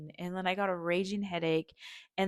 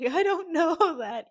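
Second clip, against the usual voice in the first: laughing doubt; 11 semitones higher, 6 dB louder, slower than usual. Retold or imitated. Doubting friend's reassurance.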